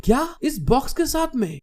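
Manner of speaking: astonished. A narrator's reading. Girl told to stay with the box, astonished. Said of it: "हैरान होते हुए".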